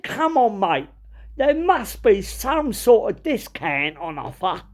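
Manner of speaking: high, drawn-out Cockney wheedling. Retold or imitated. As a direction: haggle like a stroppy East Ender. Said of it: "this guy was proper Cockney".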